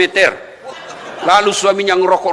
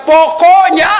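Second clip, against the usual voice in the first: imitating a wife cutting him off, higher and louder; mock nagging wife; 8 semitones higher, 6 dB louder, slower than usual. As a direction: play the wife snapping her final word. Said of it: "kalau dia sudah bilang". (meniru istri yang ngotot, lebih tinggi dan keras)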